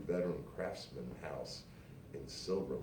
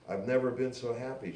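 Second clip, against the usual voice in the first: bright and higher, louder with joy; gushing; 7 semitones higher, 5 dB louder than usual. A narrator's reading. Woman dancing with young guy gushing happily. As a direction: gush with delight. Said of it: "she gushed".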